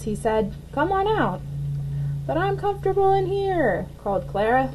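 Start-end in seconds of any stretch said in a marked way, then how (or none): 0.7-3.9 high, small voice from inside; a tiny voice from inside the egg; high little caterpillar voice. A narrator's reading. Caterpillar calls in, Clara answers from her egg, high and small.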